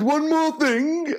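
slow, high Santa call; hammed-up lofty Santa voice; Father Christmas voice. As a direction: draw it out as a jolly Santa. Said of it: "I said".

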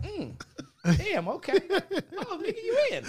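high, hushed and amused, half laughing; a sneaky impressed greeting, voice up high; mock surprise. Retelling his own surprised greeting.

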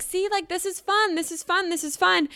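high, insistent chant of reassurance; encouraging friends. Retold or imitated. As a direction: imitate teens coaxing a scared friend, pitched high. Friends reassuring the sobbing girl.

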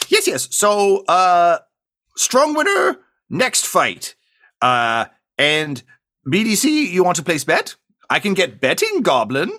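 high, slow put-on bookie voice; a squeaky-high NPC clerk, slowing down; booking agent voice. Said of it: "he nods and he says".

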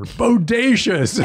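high, very slow drawn-out bodacious; mild mock yell of bodacious at a passing girl; mock shouted catcall. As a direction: stretch out bodacious like a guy yelling from a car. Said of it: "some guy screamed out at her".

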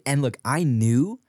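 normal talking voice

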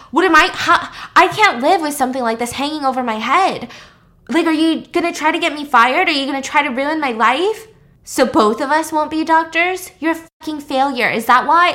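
high, loud indignant protest; agitated outburst. Retold or imitated. Cornered man confronting her over the video, agitated. Cooper cornered, acted quarrel.

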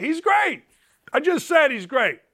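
high-pitched, insistent defence; exasperated, voice jumping up; exasperated protest. Real, not acted. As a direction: protest that you already conceded the point. Protesting to imagined objectors.

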